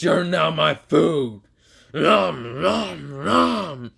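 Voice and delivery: slow hungry monster voice, then chomping; monster gloat. Acted. Venom telling his prey he will eat him, monstrous. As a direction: drag it out then munch.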